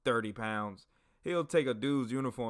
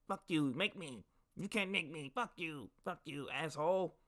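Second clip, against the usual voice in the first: high, thin imitation of defiant cursing; mock a stubborn guy swearing back; cussing-out comrade; 6 semitones higher, 4 dB quieter than usual. Difficult soldier cussing out those correcting him, mock defiant. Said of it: "he'll cuss us out".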